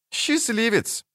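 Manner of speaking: high, soft sugary tone; sugary sweet. A narrator's reading. Ciri sweetly teases the dwarf beside her. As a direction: coo it sweetly, teasing. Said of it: "сладенько проговорила Цири".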